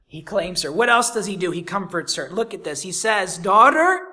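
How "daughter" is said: calling out. Acted. Jesus calls to the woman.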